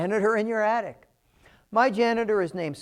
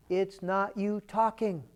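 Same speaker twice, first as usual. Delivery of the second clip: ordinary talk, nothing marked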